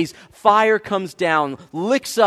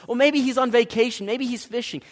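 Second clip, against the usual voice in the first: higher, teasing mock helpfulness; making fun; 6 semitones higher than usual. Elijah mocking the pagans' absent god, teasing. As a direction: offer it as a playful jab. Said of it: "he starts to make fun of them".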